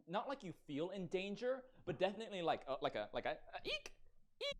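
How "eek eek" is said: high, soft squeak; rat-like eek. Pretend. Imitating a rat's squeak.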